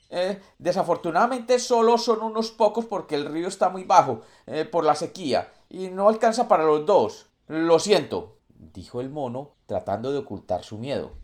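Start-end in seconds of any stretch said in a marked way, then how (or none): 0.1-8.4 high, anxious little monkey; a scared monkey trying to sound polite; nervous monkey voice. Acted. Monkey answers the puma nervously.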